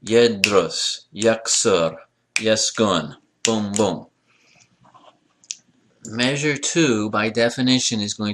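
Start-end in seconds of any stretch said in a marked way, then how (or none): 0.0-4.1 Yedrus, yaksur, yeskun as two separate beats each; two-syllable rhythm. Shown as a demonstration. Teacher showing the basic pattern, Yedrus to boom boom in two beats. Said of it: "the basic pattern has two syllables".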